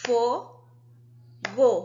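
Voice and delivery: "Fo-go" in two distinct beats; separated syllables. Shown as a demonstration. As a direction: split "Fo-go" with a clear pause between syllables. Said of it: "Duas vezes". ("Fo-go" em duas sílabas bem separadas)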